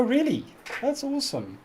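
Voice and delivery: ordinary talk, nothing marked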